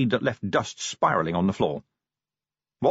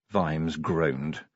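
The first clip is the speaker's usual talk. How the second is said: usual speaking voice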